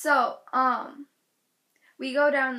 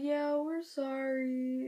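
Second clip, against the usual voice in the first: slow, soft, drawn-out mock sorry; awkward apology; 6 dB quieter, slower than usual. Girl's dad to hurt girl, awkwardly drawled apology. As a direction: imitate a half-hearted apology. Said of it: "the most awkward apology".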